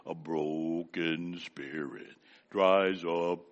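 low, slow, put-on solemn voice; mock-solemn little girl's low voice; changed child voice. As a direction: drop the voice deep and drag it out like a three-year-old playing grave. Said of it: "change her voice".

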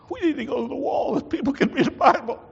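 rally the congregation with joy; rousing preaching. Real, not acted. Preacher urging the congregation to read the Bible, rousing.

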